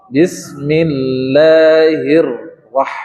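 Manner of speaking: Bismillahirrah- with the doubled r pressed, very slowly. Shown as a demonstration. Teacher demonstrates the tasdid on ra to the class, very slowly. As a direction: drag out Bismillahirrah- and lean on the doubled r. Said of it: "pada huruf ra".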